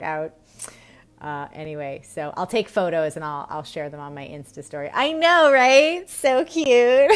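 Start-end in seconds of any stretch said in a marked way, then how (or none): 4.9-7.1 high, loud and delighted; excited gushing. Real, not acted. Telling viewers about her plans, excitedly gushing. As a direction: gush like you can't contain it.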